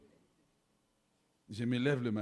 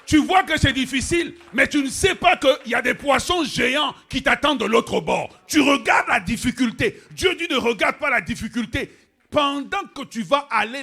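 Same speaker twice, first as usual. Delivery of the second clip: loud, high preacher's cry; full force preaching; 10 semitones higher, 15 dB louder than usual. Acted. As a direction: hammer it at the congregation. Prêche à pleine voix. (prédication à pleine voix)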